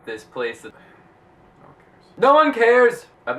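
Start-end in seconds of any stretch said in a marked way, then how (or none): 1.6-2.2 whispered under breath. Real, not acted. Whispered aside then yelled. 2.2-3.1 loud high yell; shout. Real, not acted. Yelled right after whisper.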